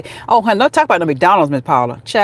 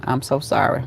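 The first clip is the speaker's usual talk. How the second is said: ordinary delivery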